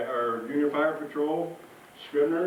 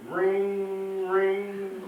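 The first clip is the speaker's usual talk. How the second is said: phone ring imitated; 3 semitones higher than usual. Acting out a phone call.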